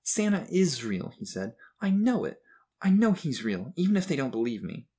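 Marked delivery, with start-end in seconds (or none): 0.0-1.1 put-on high, slow, upset boy; tearful insistence. A narrator's reading. Crying boy alone in hallway, tearfully insistent. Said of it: "wiped more tears from his eyes". 1.8-4.9 put-on high, louder boy's protest, easing off; tearful insistence. A narrator's reading. Crying boy alone in hallway, tearfully insistent. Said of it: "wiped more tears from his eyes".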